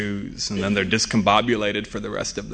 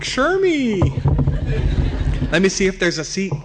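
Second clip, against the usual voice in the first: much higher, sing-song friendly woman; high sweet female voice; 8 semitones higher than usual. Retold or imitated. Airline desk classmates greeting Sherman, high and sweet. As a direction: coo it like a delighted old classmate. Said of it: "they're like".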